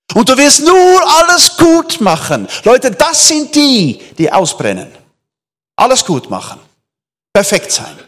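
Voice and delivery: full-force sermon delivery; fervent preaching. Acted. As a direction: drive the point home to the church. Preaches to congregation.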